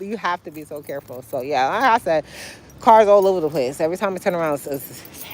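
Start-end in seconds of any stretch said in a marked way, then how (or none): 0.0-1.2 lower, softer echo of the warning; soft repeat. Shown as a demonstration. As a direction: drop it down to a quiet aside.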